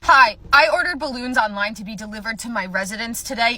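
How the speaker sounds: loud, rising indignant complaint; acted furious customer, pitch climbing; irate caller. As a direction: complain down the line, building to outrage.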